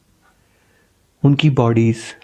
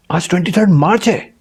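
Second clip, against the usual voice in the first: stunned question; 6 semitones higher than usual. Acted. Father shocked by the date.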